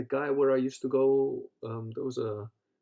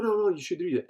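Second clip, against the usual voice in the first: higher, louder retold insistence; insistent; 7 semitones higher, 6 dB louder, faster than usual. Club owner overruling a doubtful student, insistent. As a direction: brush off the objection and urge.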